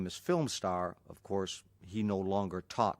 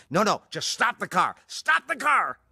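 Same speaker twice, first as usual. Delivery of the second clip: much higher and louder, desperate; panicked demand; 11 semitones higher, 7 dB louder than usual. Retold or imitated. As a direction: insist the car stops now. Bruce panicking in car.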